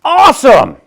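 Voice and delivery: high, loud delighted cry; excited shout. Acted. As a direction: burst out with joy. Ruby thrilled to learn singing.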